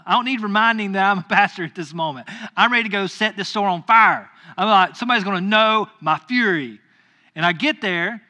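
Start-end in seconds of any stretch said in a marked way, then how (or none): 0.0-4.3 loud, heated comeback; hammed-up furious outburst, raised voice; retold anger. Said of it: "I'm so angry at the time". 5.0-6.8 loud mock-menacing vow; hammed-up wrath, forceful; retold fury. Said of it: "I'm so angry at the time".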